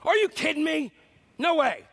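mock angry outburst. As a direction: blow up in anger. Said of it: "explosion of great anger".

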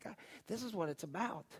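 very soft, hushed aside; quiet realization. Retold or imitated. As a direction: drop to a near murmur, as in private thought.

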